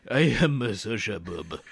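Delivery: put-on Japanese-Russian accent. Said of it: "it's like a Japanese Russian".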